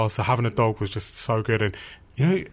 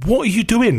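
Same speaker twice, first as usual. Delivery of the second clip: raised, higher and louder exasperation; annoyed; 10 semitones higher, 6 dB louder than usual. Retold or imitated. Nephew challenging his uncle's odd TV tinkering, annoyed. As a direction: demand it, fed up and louder. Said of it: "just getting a bit annoyed now".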